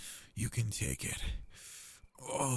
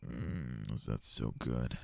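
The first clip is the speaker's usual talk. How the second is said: lower, softer and tender; soft afterglow; 8 semitones lower, 4 dB quieter than usual. Acted. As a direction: ease down, gentle and spent.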